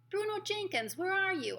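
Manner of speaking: high, sing-out searching call. A narrator's reading. Mouse boy searching the ballroom for Bruno, calling out. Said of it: "I called out".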